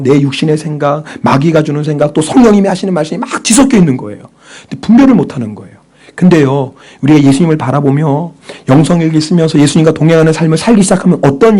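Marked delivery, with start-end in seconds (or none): none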